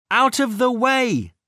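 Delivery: high urgent warning cry. A narrator's reading. Minnie warns as Rex charges the door, high urgent cry. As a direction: call a warning, pitched well up but not bellowed. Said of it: "cried Minnie".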